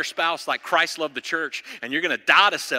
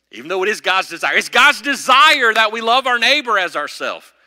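loud, high, driving sermon climax; full-force preaching; 7 semitones higher, 9 dB louder than usual. Acted. Pastor rousing the congregation with loud, soaring preaching. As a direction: preach it out to the rafters.

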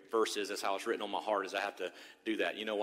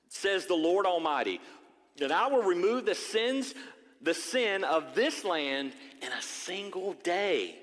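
higher, slow and declaiming; solemn raised scripture read; prophetic reading; 6 semitones higher, slower than usual. Acted. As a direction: proclaim the prophecy over the church. Reads prophecy to congregation.

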